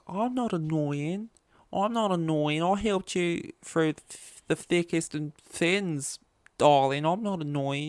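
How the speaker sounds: high, slow, wounded Aussie soap protest; [name] defending herself in Aussie soap drama; bad Australian accent. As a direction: plead melodramatically in a put-on Australian voice. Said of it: "convoluted way of bad accents".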